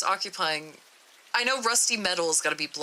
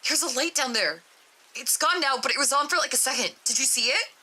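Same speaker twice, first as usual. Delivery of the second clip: excited alarm; 7 semitones higher than usual. Acted. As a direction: blurt it out, alarmed. D-9 sees a light in the hole.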